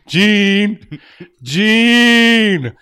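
high, loud heroic call; Cyclops voice. Pretend. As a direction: call out like a comic-book hero. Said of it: "I have the best Cyclops voice".

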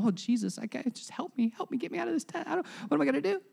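half-hearted panicky whine; mock panic. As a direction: imitate someone begging to be rescued. Mocking a panicked believer in crisis.

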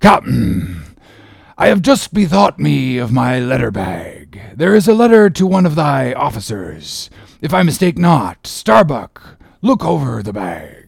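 lightly voiced stolid sea captain; Ahab, stolid captain. A narrator's reading. Ahab telling the visiting captain about a letter, mildly in character. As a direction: voice a captain stolidly turning to business, lightly acted.

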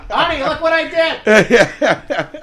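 a squeaky proud little voice; mock proud voice. Mocking someone proud.

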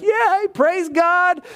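hammed-up emotional gushing; mock emotional believer. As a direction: gush it like an all-feelings enthusiast. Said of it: "not just to be emotional, like".

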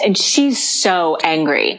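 ordinary delivery

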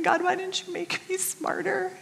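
a retold, tearful plea to God; tearful cry to God. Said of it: "breaking down and crying and going".

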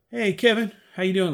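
high, bright-eyed hello to a star; eager greeting. Retold or imitated. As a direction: call out hopefully to Kevin. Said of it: "and I said".

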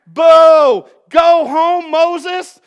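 a crowd shouting down a speaker; booing crowd. Retold or imitated. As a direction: drive him off the stage. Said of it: "the people went".

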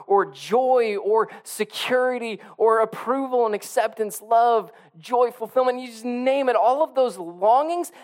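high, loud, impassioned preaching; fired-up pulpit preaching; preaching at full force. Real, not acted. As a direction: lift the congregation, full voice.

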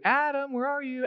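high calling voice, searching; calling out as God. Retold or imitated. God calling for his hiding son, high and searching.

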